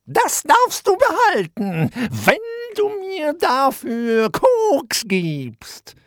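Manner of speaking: high, loud, slow falsely friendly witch; sweet-talking witch. Acted. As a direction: coax him like a scheming witch. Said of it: "grinste dabei so freundlich sie konnte". (übertrieben freundliche Hexenstimme)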